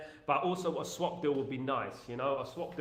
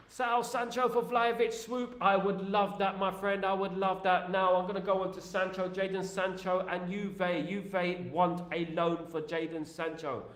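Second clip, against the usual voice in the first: superchat read; 4 semitones higher than usual. Acted. Reading superchat in high voice.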